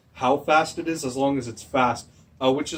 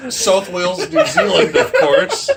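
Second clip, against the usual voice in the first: loud laugh through the words, higher; laughing loudly; 7 semitones higher, 11 dB louder than usual. Real, not acted. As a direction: crack up mocking the mix-up. Mocking the Wales/New Zealand mix-up.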